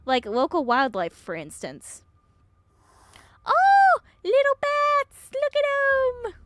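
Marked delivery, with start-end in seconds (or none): 3.4-6.3 high, loud, drawn-out squeal of delight; excited cooing. Acted. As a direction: squeal slowly over cute little animals. Spotting bats in the mine.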